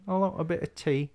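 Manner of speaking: put-on high-pitched caricature English accent; mock English, high and polite. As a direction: ask in a hammed-up English voice. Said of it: "with that accent".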